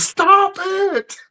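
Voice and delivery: very high mock yell, drawn out; high-pitched imitation of a yelling sister; imitated yelling. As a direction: mimic a sister yelling from another room. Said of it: "can hear his sister yelling".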